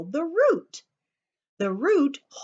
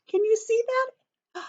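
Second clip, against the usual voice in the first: excited question; 11 semitones higher than usual. Real, not acted. Asking children about picture.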